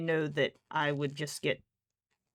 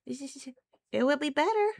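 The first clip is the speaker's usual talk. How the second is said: high, loud mock-posh pleading; owner's pleading voice; 10 semitones higher, 4 dB louder than usual. Owner voicing herself pleading with the cats, mock posh. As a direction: plead with the cat, hammed up. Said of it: "And it's like".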